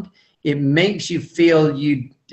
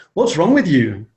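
accusing tone. Retold or imitated. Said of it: "with that face".

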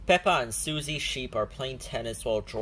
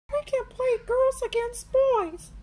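put-on high little girl apology; Peppa Pig voice; 5 dB louder than usual. A narrator's reading. Peppa apologises to little brother George, high voice. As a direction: say sorry sweetly. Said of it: "says Peppa".

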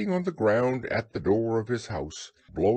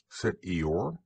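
usual speaking voice

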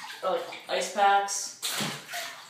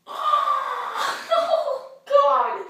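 laughing; 14 semitones higher, 4 dB louder than usual. Real, not acted. Laughing in cold water.

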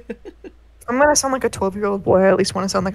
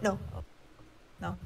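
ordinary delivery

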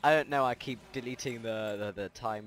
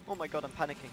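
normal talking voice

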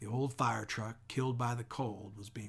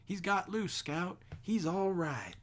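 higher, louder burst of relief; a boy crying out to his sister in relief; relieved cry; 8 semitones higher, 5 dB louder, slower than usual. Acted. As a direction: cry out that he is safe. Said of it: "till Jem cried".

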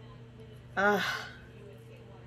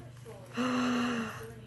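gasp; 3 semitones higher than usual. Real, not acted. Opening a gift box.

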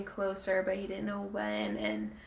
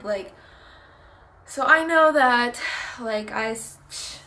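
normal talking voice

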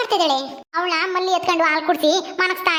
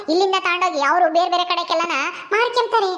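normal talking voice

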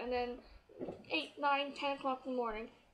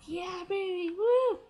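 loud, high whoop of joy; excited cheer; 6 semitones higher, 7 dB louder, much slower than usual. Retold or imitated. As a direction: cheer yourself on, then whoop. Excited alone waiting for [name].

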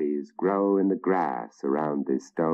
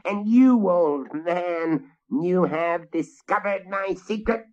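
menacing witch; 12 semitones higher than usual. Acted. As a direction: threaten him like a vengeful hag. Witch speaks at length.

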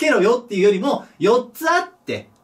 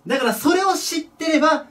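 ordinary talk

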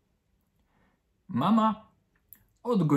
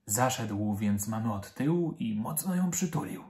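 usual speaking voice

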